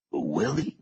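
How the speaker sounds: weak, croaked appeal; croaking. A narrator's reading. Bound captive appealing to Willie, croaking voice. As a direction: croak the name as a question. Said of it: "croaked Herod".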